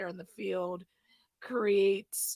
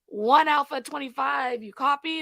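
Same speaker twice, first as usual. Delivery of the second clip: police radio; 4 semitones higher, 6 dB louder than usual. Retold or imitated. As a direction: call the unit like a dispatcher. Dispatcher calling unit on radio.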